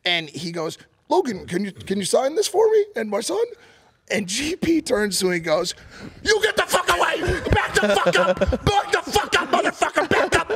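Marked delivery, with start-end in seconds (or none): 1.1-3.4 high-pitched polite asking voice; imitated fan request. Fan politely asks for signature. 6.2-10.5 high, loud enraged shouting; retold furious yelling. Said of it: "parents are covering their fucking ears".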